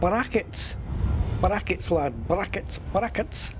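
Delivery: a light, playful Scottish lilt on brackets, brackets rising at the end; a hint of Scottish on each brackets, half in jest; slightly Scottish accent. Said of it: "brackets had to be in a slightly Scottish accent there".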